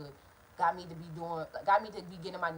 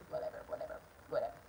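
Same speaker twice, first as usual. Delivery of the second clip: whisper; 10 semitones lower, 11 dB quieter, much faster than usual. Real, not acted. Dismissive under breath.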